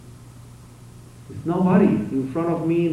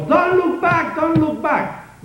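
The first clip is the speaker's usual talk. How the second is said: much higher, louder mock shout; high, loud warning, second one slower; retold police yell; 5 dB louder, much slower than usual. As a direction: call it across the street as a warning.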